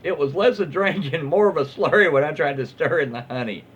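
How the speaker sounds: ordinary talk, nothing marked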